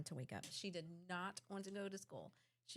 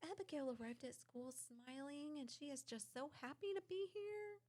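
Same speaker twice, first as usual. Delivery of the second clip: sweet teacher voice; 7 semitones higher, 5 dB quieter than usual. Retold or imitated. Quoting teacher's text.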